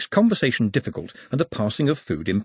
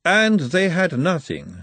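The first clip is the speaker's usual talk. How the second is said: Margalotta; 8 semitones higher, 3 dB louder, slower than usual. A narrator's reading. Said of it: "said Margalotta".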